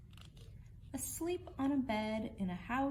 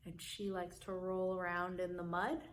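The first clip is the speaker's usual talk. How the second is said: usual speaking voice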